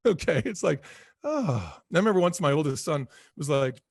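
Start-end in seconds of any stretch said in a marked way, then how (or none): 1.2-1.7 falling sigh of release; relieved sigh. Shown as a demonstration. Speaker showing what huge relief is like, sighed out. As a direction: sigh it out, as if a weight drops. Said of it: "there's this huge relief".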